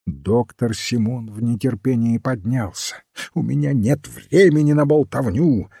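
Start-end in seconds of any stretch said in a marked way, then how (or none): 3.3-5.7 raised, impatient outburst; understated angry cry, higher pitched; angry shout. A narrator's reading. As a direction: snub them with mild anger, voice lifted. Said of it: "гневно крикнул он".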